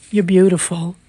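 ordinary delivery, nothing marked

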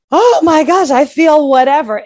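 hammed-up alarm at a feeling; pearl-clutching. Mocking an anxious person's overreaction, pearl-clutching alarm. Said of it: "the gasping and clutching of pearls".

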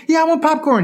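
put-on high, eager kid voice; whiny child. Nine-year-old offered popcorn, whiny. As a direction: imitate a tearful kid wanting a treat.

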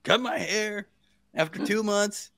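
high, disbelieving listing of chores; exasperated. Real, not acted. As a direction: rattle it off, annoyed and amazed. Exasperated retelling of going back.

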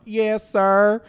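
put-on loud twangy Texas kid; a hint of a boy with a strong Texas twang; young Texas twang. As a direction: say it with a thick childhood drawl. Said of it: "I'm like".

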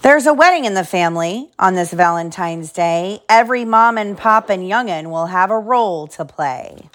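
lively storybook reading; reading to children. Acted. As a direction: read it like bedtime story. Reads picture book aloud.

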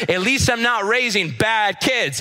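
high, drawn-out mock taunt; retold parting jab. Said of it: "the last thing I heard is".

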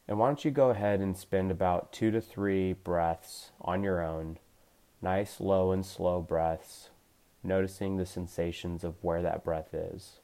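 gentle meditation coach voice; guided meditation. Acted. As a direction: ease them into calm awareness, unhurried. Leads a guided body scan.

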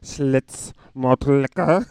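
loud, high mock German bark; mock German accent. Comic showing Schlitz sounds German, mock German accent. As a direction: shout it as hammed-up German. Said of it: "It sounds like a German word".

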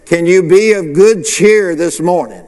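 ordinary talk, nothing marked

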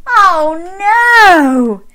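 loud, high, long falling wail; mock dismay. Storyteller lamenting for Mister Bear, loud mock dismay. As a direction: wail in playful sympathy.

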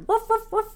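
yappy barking imitation; high dog barks. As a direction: bark it like a small dog. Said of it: "all of their music is like".

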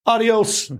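high, breezy kiss-off to the clinic; mocking goodbye. Fed-up patient quitting rehab over the bill, mock-cheery. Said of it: "I was mad".

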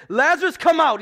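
loud commanding call; booming command to Lazarus; crying out. Acted. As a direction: call the dead man out of the tomb. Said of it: "he cries out to Lazarus".

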